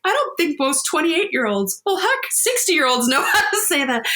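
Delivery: high, laughing through happy tears; teary laughing disbelief. Retold or imitated. Mother telling of her son's maturity, laughing through tears. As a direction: gush in amazement, half crying half laughing. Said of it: "I started crying and I'm like".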